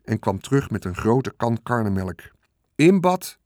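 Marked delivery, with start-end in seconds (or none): 2.7-3.4 higher, louder cheerful shout; cheerful call. A narrator's reading. Farmer cheerfully announcing the pig's buttermilk bath, loud and happy. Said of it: "riep meneer Hartsuiker opgewekt".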